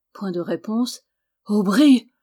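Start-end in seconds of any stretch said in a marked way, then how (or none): none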